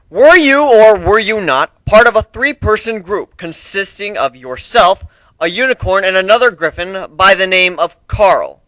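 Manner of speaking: formal courtroom judge; stern judge. Acted. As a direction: open the trial with authority. Judge and griffin trade lines.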